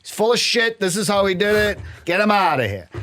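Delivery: mock inmate verdict. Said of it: "they're like".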